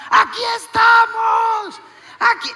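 high, drawn-out full-volume shout; desperate calling. Retold or imitated. Couple hailing their boat from afar, acted desperate shout. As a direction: holler to a boat far away.

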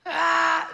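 un grito corto y alto de desesperación. Retold or imitated. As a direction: suelta un ¡Ah! desesperado y fuerte. Gritaron de la desesperación. (grito desesperado imitando a los hombres)

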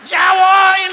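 high, loud, strained with a nervous laugh; father pinned to the wall, answering his kids in mock terror; scared reply. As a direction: call back while frozen with fear.

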